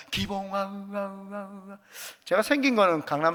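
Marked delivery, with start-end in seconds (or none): 0.1-1.8 a comic echo that dies out, played for a laugh; God's echoing voice, retold. Pastor retries the joke for the congregation, echo fading. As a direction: call the name, then let 우와 echo away into silence. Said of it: "다시 한번 하겠습니다".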